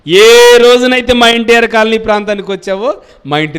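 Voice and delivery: loud, high pleading insistence; a mother insisting her guest stays; woman's eager invitation. Acted. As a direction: urge the prophet to stay, loudly. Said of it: "ఏమని చెప్తుందో తెలుసా అండి".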